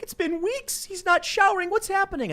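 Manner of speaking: wife pleading, high and rising; worried wife. Acted. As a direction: panic about the husband in a high voice. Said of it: "his wife is like".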